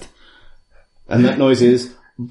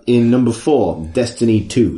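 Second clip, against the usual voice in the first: ordinary talk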